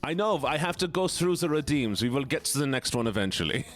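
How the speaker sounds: put-on German accent. Streamer honouring a chat redeem, mock German accent. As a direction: speak English with a playful German accent. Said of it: "we have to do this German voice".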